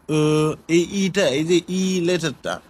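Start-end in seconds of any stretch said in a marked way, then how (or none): 0.1-0.5 ও as a drawn-out "uh", not "ee"; not like English E. Shown as a demonstration. Teacher showing students the letter E, drawn-out "uh". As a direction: say ও as a long "er" without the r sound. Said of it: "এটার উচ্চারণটা কিন্তু ই, ই এর মতো না".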